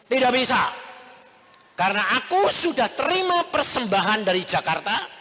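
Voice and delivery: loud firm refusal. Retold or imitated. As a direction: refuse it firmly and loudly. Refusing friend's payment firmly.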